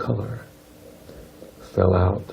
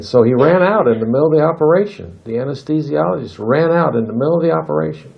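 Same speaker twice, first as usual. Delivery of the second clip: excited retelling; 7 semitones higher, 4 dB louder, faster than usual. A narrator's reading. Anesthesiologist flees mid-surgery.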